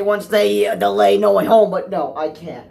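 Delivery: usual speaking voice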